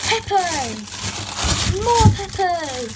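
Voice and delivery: loud, high excited call. Real, not acted. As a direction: cheer as if delighted. Excited at peppers.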